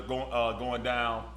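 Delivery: usual speaking voice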